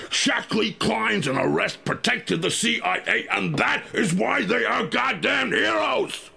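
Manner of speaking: high, ringing, shouted proclamation; patriotic shout. Acted. Agent proclaims CIA heroes to the listener, shouting with pride. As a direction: trumpet it like a rallying cry.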